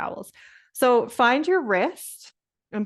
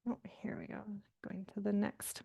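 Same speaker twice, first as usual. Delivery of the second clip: muttered to herself while clicking ahead; soft whisper while moving to the next slide; whispered aside; 16 dB quieter, faster than usual. Real, not acted. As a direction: whisper it under your breath.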